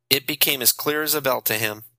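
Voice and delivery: ordinary delivery, nothing marked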